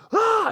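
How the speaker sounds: high-pitched imitated scream; sudden high "ah" of alarm; crowd gasp-scream. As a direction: cry out like a shocked crowd. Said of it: "Everyone went".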